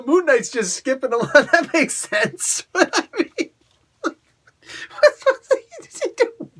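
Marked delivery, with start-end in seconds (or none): none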